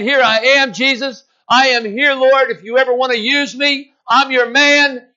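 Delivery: eager calling out. Retold or imitated. Eager disciple calling to Jesus.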